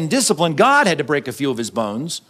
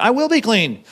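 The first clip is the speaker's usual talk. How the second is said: pitched up, sure stress on "will"; confident declaration; 5 semitones higher than usual. Acted. David trusting God's forgiveness, confident on "will". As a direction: declare it with conviction, lifting "will". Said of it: "he's totally set on the veracity".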